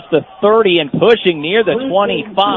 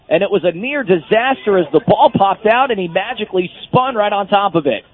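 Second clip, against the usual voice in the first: ordinary talk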